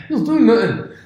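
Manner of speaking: put-on high, loud hammy Irish accent; a clumsy put-on Irish accent; bad Irish accent. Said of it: "my really bad attempt at an Irish accent".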